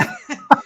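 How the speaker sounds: very loud, high burst of laughter. Real, not acted. Co-host after her son's request for candy, bursting out laughing. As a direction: crack up loudly and helplessly. Cracks up after interruption by son.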